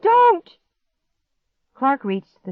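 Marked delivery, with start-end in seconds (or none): 0.0-0.6 one very high, loud scream; panicked shriek. A narrator's reading. Girl's last warning to Clark, shrieking. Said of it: "I shrieked".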